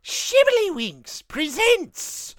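very high, slow leprechaun voice. Pretend. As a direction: squeal it out like a leprechaun. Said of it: "in your leprechaun voice".

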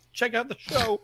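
breaking off to cough; words cut by a cough; coughing fit. Real, not acted. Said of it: "I'm sorry I'm coughing so much".

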